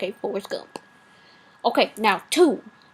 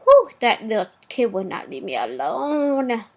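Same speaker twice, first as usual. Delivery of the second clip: ordinary talk, nothing marked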